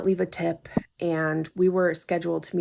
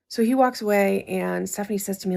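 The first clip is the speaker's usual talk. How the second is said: ordinary talk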